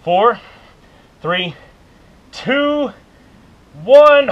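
countdown rising to a shout. Real, not acted. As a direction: call out the final count. Coach counting down the plank.

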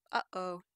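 "uh-oh" split by a tightening in the throat; glottal stop. Shown as a demonstration. Lecturer has listeners feel the throat, glottal stop. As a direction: cut the airflow between "uh" and "oh". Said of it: "feel that little bit of tightening".

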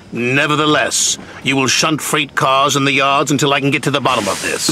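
stern, steady order; severe. A narrator's reading. Sir Topham Hatt ordering Percy to shunt, severely. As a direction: hand down the punishment firmly. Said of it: "spoke severely to Percy".

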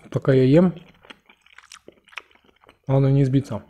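talking while chewing; speaking around food; mouth full. Real, not acted. Seen 0: Dumpling forked into mouth at 1.4, then visible chewing through 6.2.

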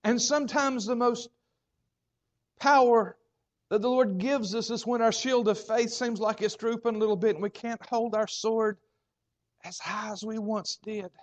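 fervent preaching. Acted. Preaching at full force.

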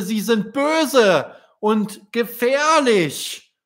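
put-on high, slow wondering child's voice; a little swan marvelling at tiny people; amazed child. Stefan amazed at humans.

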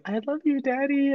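put-on high, soft little-girl voice; mock little kid saying I love you; daughter's voice. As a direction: coo it like a small daughter to her dad. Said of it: "like, so, like".

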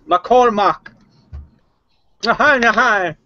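loud, high call in a put-on Indian accent; Indian accent shout. Native visitor calling out to the settler from outside, loud Indian accent shout. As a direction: holler his name across the yard in a hammed-up Indian accent. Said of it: "I shout loudly in my, uh, Indian accent".

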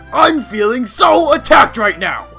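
mock offended.

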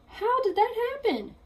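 high, amazed question; puzzled astonishment at himself; wondering. Acted. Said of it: "he wondered".

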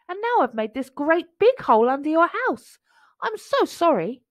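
high, lightly voiced mole; small apologetic creature; little mole. A narrator's reading. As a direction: confess it high and sheepishly. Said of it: "said a little mole".

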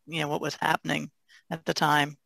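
usual speaking voice

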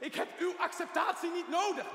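shouted high and loud in defiance; angry shout. Acted. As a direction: throw it back in his face. Patient fights back at therapist. (boos en uitdagend geschreeuwd)

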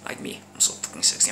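whispered self-praise; boastful whisper. Real, not acted. Vlogger bragging to his bros, smug whisper. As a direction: whisper it smugly to the camera.

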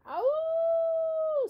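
long high howl, loud; dog howl. Acted. Dog howling over the preacher's sermon, long high howl. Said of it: "said Winn-Dixie back".

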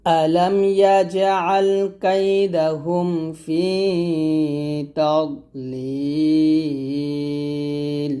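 slow melodic recitation, rising on the openings; Quran recitation. Shown as a demonstration. Teacher reciting the verse for students to repeat. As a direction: recite the verse slowly, chanting. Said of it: "আবার আমার সাথে".

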